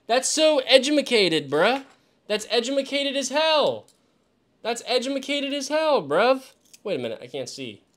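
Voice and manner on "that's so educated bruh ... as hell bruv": higher, playful jokey praise with edumacated; mock impressed. Real, not acted. Streamer praising the glasses to chat, mock impressed. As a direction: tease it like a goofy compliment. Joking about the clever screwdriver.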